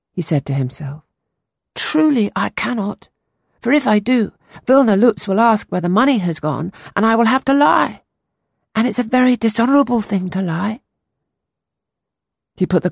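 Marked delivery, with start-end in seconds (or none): 1.8-10.8 put-on higher, earnest young boy voice. A narrator's reading. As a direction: play the anxious ten-year-old boy. Boy Peter voiced in story.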